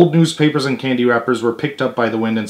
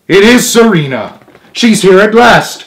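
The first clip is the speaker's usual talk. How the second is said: much higher and louder, bursting with joy; overjoyed cry; 8 semitones higher, 8 dB louder than usual. Acted. Louis the swan crying out with joy at seeing Serena. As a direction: shout it out like a prayer just answered.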